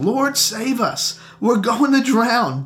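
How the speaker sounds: a touch of panic in the disciples' cry; mild panicked cry. A narrator's reading. Disciples waking Jesus in storm, frightened plea.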